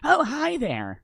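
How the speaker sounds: put-on high squeaky anime girl voice; cutesy baby talk greeting; uwu voice. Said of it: "I'll talk in an uwu voice".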